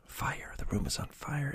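usual speaking voice

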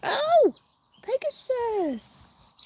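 high, drawn-out fond coo. Real, not acted. Owner cooing the toy's name to the dog, high and slow. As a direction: stretch the name out lovingly.

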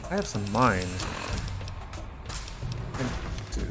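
usual speaking voice

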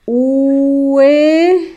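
drawn-out word called out for writing; dictated word. Shown as a demonstration. Teacher dictating to pupils, word drawn out. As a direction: stretch the word for the class.

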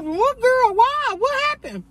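high, bewildered questions; confused. Retold or imitated. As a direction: sound baffled and caught off guard. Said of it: "I'm like".

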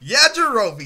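loud teasing yell of the name; hammed-up brother yelling Yajirobe; mock yelled catchphrase. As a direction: shout the name to bug him. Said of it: "he's all like".

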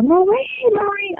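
high, drawn-out ghostly calling; eerie ghost call. Retold or imitated. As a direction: call her name like a ghost. Ghost calling her name eerily.

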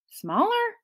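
high, rising disbelief; startled, squeaked-up query; incredulous echo. A narrator's reading. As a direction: echo it as a baffled question. Boy echoes in disbelief.

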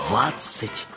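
ordinary delivery

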